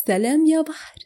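laughing. A narrator's reading. She answers his goodbye by his new nickname, laughing. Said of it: "وبضحكة قالت".

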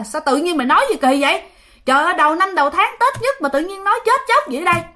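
imitating relatives scolding, high and a bit fast; mock scold about bad luck at Tet. Relatives at the Tet meal scolding the father, imitated scold. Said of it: "mọi người mới la".

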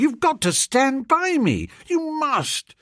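very high, imploring; beg, pitch climbing high; desperate pleading. A narrator's reading. Narrator begging Freddy to stand by him, desperate pleading. Begging his pal to stay.

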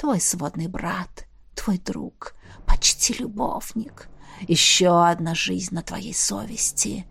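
slow, very soft whispering; sighing. A narrator's reading. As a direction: sigh out each reproach, hushed and sinister. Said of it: "вздохнул Хаос". (тихий протяжный укор шёпотом)

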